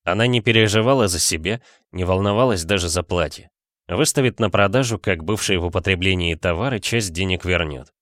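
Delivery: ordinary delivery, nothing marked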